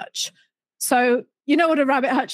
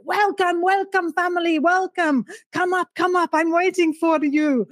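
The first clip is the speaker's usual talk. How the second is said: put-on higher, effusive Italian-accented welcome; Italian host; 5 semitones higher than usual. Italian host welcoming the visiting family up from his balcony, effusive accent. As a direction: call out grandly in an Italian accent. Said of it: "you know how Italians".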